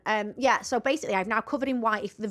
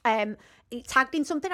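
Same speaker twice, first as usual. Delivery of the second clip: ordinary talk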